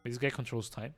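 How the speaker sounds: normal talking voice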